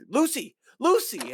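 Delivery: high, urgent call across the room. Retold or imitated. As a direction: call her name as you run in. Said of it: "he's like".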